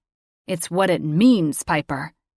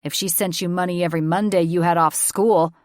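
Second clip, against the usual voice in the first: acerbic; 5 dB louder than usual. A narrator's reading. Father mocks her excuse, acerbic and loud. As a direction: cut her down with sarcasm. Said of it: "his voice was acerbic".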